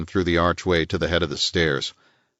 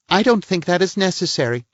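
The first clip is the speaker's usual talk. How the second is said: a higher-voiced prim society lady; lady's voice, pitched up and a little pert; voiced woman; 11 semitones higher than usual. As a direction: play the indignant lady of the house. Woman figure's lines.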